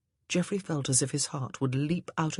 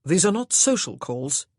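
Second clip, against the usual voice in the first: louder, clipped refusal; louder curt husband; 6 dB louder than usual. Acted. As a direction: cut her off curtly. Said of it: "he said curtly".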